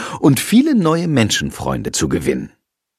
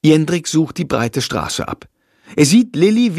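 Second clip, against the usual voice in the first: ordinary delivery, nothing marked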